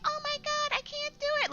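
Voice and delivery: extremely high, helpless whine; mocking whiny gamer. Streamer mocking complaining testers, very high whine. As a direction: whimper it way up high like a spoiled player.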